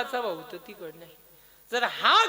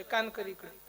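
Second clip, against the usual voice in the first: softer, secretive request; confidential aside; 2 semitones lower, 8 dB quieter than usual. Acted. Krishna wants his brother's ear, confidential aside. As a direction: draw him in close to listen. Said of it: "आता काय कानात बोलायचे दिवस आहे का".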